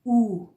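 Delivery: او as a pure long "oo"; loud, held او with no y-glide; German letter name. Shown as a demonstration. Teacher showing learners German U, او as pure "oo". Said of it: "ولی در آلمانی هست".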